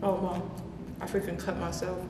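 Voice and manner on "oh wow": talking between big bites; wolfing down food. Real, not acted. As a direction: mouth full of burger. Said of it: "Like inhaling this burger right now".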